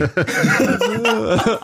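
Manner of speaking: cracking up at himself; high burst of laughter. Real, not acted. Laughing at own confusion.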